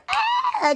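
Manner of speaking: very high squeal of pure joy; excited squeal. Retold or imitated. Recalling how hyped she was for her cousin, high squeal. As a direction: let out a tiny happy shriek. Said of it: "'cause I was geeked".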